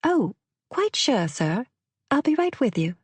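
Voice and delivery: high, lilting put-on English accent; English waitress voice, dropping on "sir"; fake English accent. A narrator's reading. Waitress obliging the husband about his wine, fake English accent. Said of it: "Her accent was impeccable".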